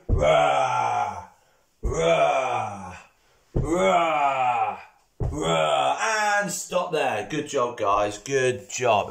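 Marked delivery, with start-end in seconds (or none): 0.1-6.0 T-Rex roar. Pretend. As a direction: roar up so the kids join in. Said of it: "I can't hear you".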